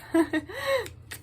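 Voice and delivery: a high, amused laugh at the card; burst of laughter. Real, not acted. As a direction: laugh out, caught off guard. Laughs after reading rough card.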